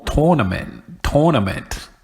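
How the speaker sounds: "tournament" with TOR as first syllable; alternative pronunciation. Shown as a demonstration. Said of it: "people say tour".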